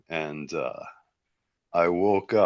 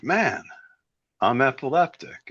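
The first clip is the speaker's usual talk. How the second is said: exasperated reply; 7 semitones higher than usual. Retold or imitated. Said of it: "I'm like".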